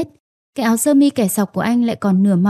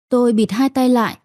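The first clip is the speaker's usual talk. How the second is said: plain reading voice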